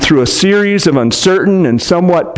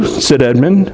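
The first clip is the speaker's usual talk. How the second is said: usual speaking voice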